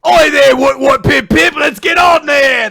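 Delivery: high, loud hammed-up British accent; mock posh British shout. Imagining riders calling dragons, hammed-up British accent shouting. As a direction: yell it like a silly posh Brit. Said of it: "stupidly British dragon".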